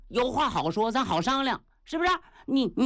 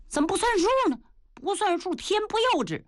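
high, indignant oath; indignant Niu Gao; 12 semitones higher, faster than usual. Acted. Niu Gao swearing to keep his word, high and indignant.

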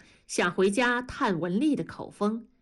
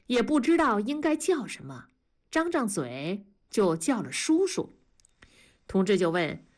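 usual speaking voice

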